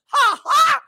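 sudden high burst of laughter; a loud laughing outburst. Real, not acted. Bursts out laughing mid-talk.

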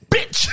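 mock hard punched Bitch. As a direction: spit out Bitch hard like a rapper. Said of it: "says the word bitch so hard".

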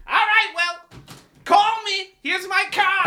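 high, distant shout from outside; calling from far off. Pretend. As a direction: yell back from down the hall. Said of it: "What's he screaming for".

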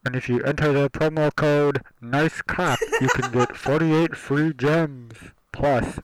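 high, loud, slowed sponsor voice; overacted sponsor spot pitch; hyped ad read.

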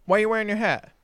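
a hint of a stern mother calling; mom calling out. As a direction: call his name across the fire like a suspicious mom. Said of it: "she goes".

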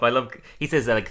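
ordinary talk

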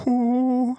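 long, high blown-out "whoo"; drawn-out high whoosh of breath; breathing-exercise exhale. Retold or imitated. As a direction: imitate a slow calming exhale. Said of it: "I was like".

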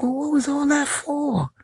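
high, slow baffled voice; mock bafflement. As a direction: ask it slow in fake bewilderment. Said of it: "I'm like".

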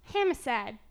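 homicide in a flat-vowelled Chicago accent. Shown as a demonstration. Guessing at local speech, Chicago accent. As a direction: try homicide as Chicago HAM-icide. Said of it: "How would they say it in Chicago?".

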